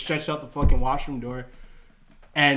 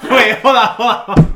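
loud, higher mock alarm through laughter; raised voice imitating a startled outburst; laughing interruption; 8 semitones higher, 12 dB louder than usual. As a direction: halt everyone while cracking up. Retold uproar, teller cracking up.

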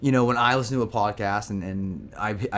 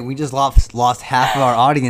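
louder, dramatic; mock outrage; 2 semitones higher, 9 dB louder than usual. Real, not acted. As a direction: react like a scandal happened. Reacting to hot take.

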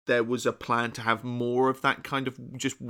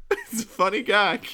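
trying not to laugh; 6 semitones higher, 3 dB louder than usual. Real, not acted. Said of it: "I'm losing it over Bat Child".

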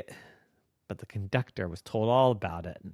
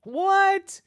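high loud gasp of a question; astonished; 5 dB louder than usual. Acted. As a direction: shriek in surprise. Girls astonished.

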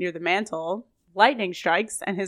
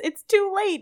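dramatic; 10 semitones higher than usual. Acted. Dramatic werewolf moment, dramatic music.

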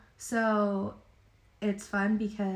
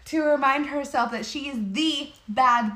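emphatic briefing; 3 semitones higher, 6 dB louder, slower than usual. Retold or imitated. Retelling a firm briefing to colleague.

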